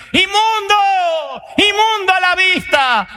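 high, long-drawn leper's cry; warning shout. Acted. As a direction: cry out a drawn-out warning, far above speaking pitch. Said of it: "tengo que gritar".

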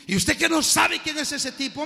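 put-on high, disbelieving kid's question; incredulous street youth. Street youth to the preacher, high and disbelieving. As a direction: sound amazed he doesn't know.

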